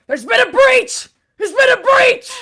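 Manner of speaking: shouted alarm. Acted. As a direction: shout the breach alarm down a hall. Guard character raises alarm in the game.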